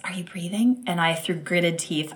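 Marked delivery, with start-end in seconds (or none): 0.0-0.8 soft whispered question. Retold or imitated. Midwife whispering to the labouring mother, concerned. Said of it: "she whispers to me".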